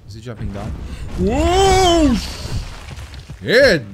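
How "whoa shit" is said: loud high whoa, then a loud curse; startled shout. Real, not acted. Player startled as the creature jumps down, loud surprised shout. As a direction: jolt of real surprise.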